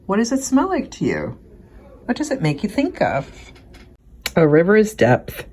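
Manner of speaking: plain reading voice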